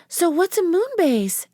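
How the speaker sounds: put-on high young girl voice. A narrator's reading. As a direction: lift into a bright little girl. Narrator voices Annie.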